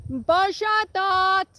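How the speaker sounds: high, loud cow call. Real, not acted. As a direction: call out across the paddock. Calling cows across paddock.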